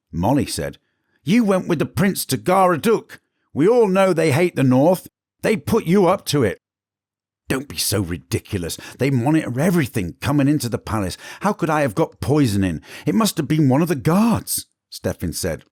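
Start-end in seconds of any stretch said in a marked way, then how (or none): 1.2-6.6 high, louder accusing servant. Acted. Molly accusing Stefan. 7.5-14.7 defensive cook. Acted. Stefan defending himself.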